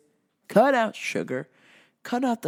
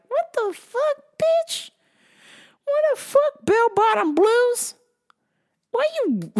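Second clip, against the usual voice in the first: very high, strained put-on voice; mock outraged outburst; 14 semitones higher, slower than usual. Outburst after the door slams, mock outraged squeal. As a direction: squeal it in exaggerated disbelief.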